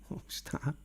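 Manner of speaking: a whispered startled what's that; whispered alarm at a noise; hushed startled. Real, not acted. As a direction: breathe it out, alarmed. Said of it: "Just heard a noise".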